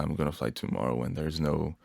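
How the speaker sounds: usual speaking voice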